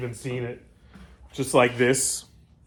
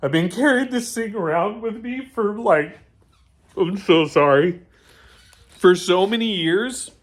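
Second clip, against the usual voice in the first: higher, louder, choked with real emotion; holding back tears; 7 semitones higher, 5 dB louder than usual. Real, not acted. Builder apologizing for tearing up over the bike, holding back tears. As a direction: push through as the tears well up. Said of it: "I'm seriously holding back right now".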